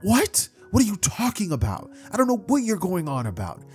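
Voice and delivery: high, bewildered protest; sharply lifted, baffled; confused disbelief. Acted. Naruto baffled by the kids' accusation, high and confused. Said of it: "wondering what the heck".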